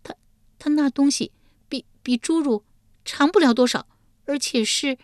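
high female voice, stumbling over words; reluctant, stammered confession; hesitant, halting. A narrator's reading. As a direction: falter with embarrassment, breaking off. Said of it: "玉莲吞吞吐吐回道".

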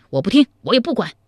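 high, rapid, heated refusal; hasty interruption. Acted. Zhao Kuangyin hastily cutting off Zhao Pu's scheme, high and heated. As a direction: break in fast and agitated, refusing. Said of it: "忙将赵普的话打断".

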